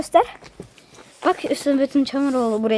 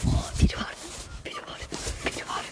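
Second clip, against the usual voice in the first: whispering while hiding; 11 dB quieter than usual. Real, not acted. Sneaking at night, someone there.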